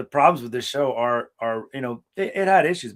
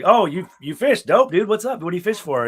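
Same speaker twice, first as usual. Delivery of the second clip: excited; 5 semitones higher, 4 dB louder than usual. Retold or imitated. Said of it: "I was like".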